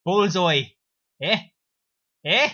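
put-on loud, nudging Irish accent; hammed-up Irish, fishing for a reaction; cartoon Irish villain. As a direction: punch it like a bad Irish comic. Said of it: "and goes".

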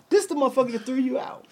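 a playful told-you-so reveal; amused reveal. Retold or imitated. Retelling pointing out the bouncer to friend, lightly excited.

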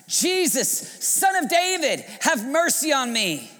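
very high, loud plea; shouted plea, pitch soaring then easing; desperate cry. Acted. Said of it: "begins to cry out".

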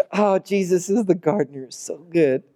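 ordinary talk, nothing marked

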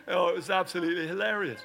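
normal talking voice

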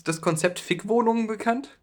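ordinary talk, nothing marked

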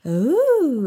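a sweeping high swoop on Ooh like a roller coaster; up and down tune. Shown as a demonstration. Former Alice performer demoing her roller-coaster tune, swooping. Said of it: "it's very up and down".

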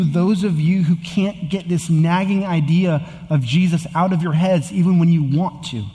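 rising, urgent sermon refrain; impassioned preacher driving the refrain home; preaching at full force. Acted. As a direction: preach it out, building each line.

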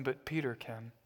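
normal talking voice

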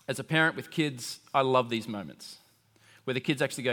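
normal talking voice

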